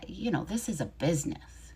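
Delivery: whispering the landlord's excuse; whispered landlord line. Retold or imitated. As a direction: whisper it, unvoiced. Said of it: "I know what you're gonna say".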